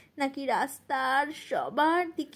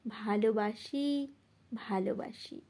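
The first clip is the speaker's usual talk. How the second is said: tender reply; 5 semitones lower, 6 dB quieter than usual. Acted. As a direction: answer tenderly, sinking softer on the repeat. Tender answer in the poem's refrain. (কোমল, নিচু স্বরে)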